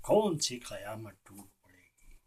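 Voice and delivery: low, subdued rhetorical question; hushed rhetorical echo. Acted.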